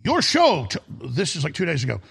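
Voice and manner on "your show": excited shopkeeper. Retold or imitated. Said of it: "And he says".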